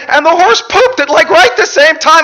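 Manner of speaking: very high, loud hysterical shriek; acted gasping, hooting hysteria; hooting with laughter. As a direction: shout it as if helpless with giggles. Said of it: "She laughed so hard she wept".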